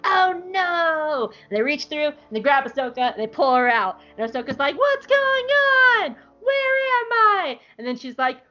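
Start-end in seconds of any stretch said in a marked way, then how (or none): 0.0-1.3 high, theatrical cry of dismay; play-acted horror; mock alarm. Three characters react to the fight. 4.8-7.6 high, drawn-out bewildered cry; hammed-up disorientation; mock panic. Character pulled out, bewildered.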